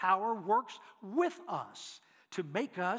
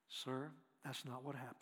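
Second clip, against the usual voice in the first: very quiet, low, measured answer; calm soft reply; 6 semitones lower, 11 dB quieter than usual. Retold or imitated. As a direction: answer softly and evenly to defuse his anger. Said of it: "Just like that".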